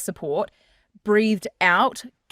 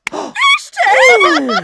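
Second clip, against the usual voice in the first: much higher, much louder cheering call; excited shout; 11 dB louder than usual. Real, not acted. Coach cheers the student's success. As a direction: yell his name in delight. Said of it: "That was it".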